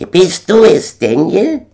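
very high, loud bird voice; gander calling out. A narrator's reading. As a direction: squawk it out as a loud question. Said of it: "drehte den Kopf nach mir um und rief".

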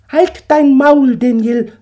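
angry eagle. Acted. Eagle silences Daniel's question, loud and high. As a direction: shut him up hard as the eagle.

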